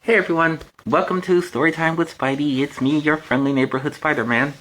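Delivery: ordinary delivery, nothing marked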